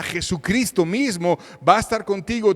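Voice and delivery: ordinary talk, nothing marked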